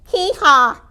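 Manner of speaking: high two-note hee-haw; donkey bray. A narrator's reading. Donkey waking the stable, high bray. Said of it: "brayed Donkey".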